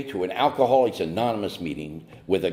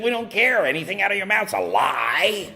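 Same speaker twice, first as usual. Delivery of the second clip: much higher, dismissive sponsor voice; scornful sponsor brushing him off, much higher; mocking sponsor; 13 semitones higher than usual.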